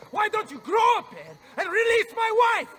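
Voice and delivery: high, loud demand with "my" stressed; furious yell. Acted. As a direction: shout at the kidnapper to give back his wife.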